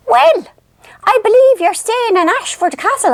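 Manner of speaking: high, put-on nosy relative voice; gossiping cousins. Cousins remarking on the castle stay, high mock gossipy voice. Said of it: "And they all kept saying".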